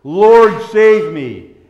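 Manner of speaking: a loud plea with "Lord" called out strongly; crying out. Shown as a demonstration. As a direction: call it out as a heartfelt cry for rescue. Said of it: "could cry out today".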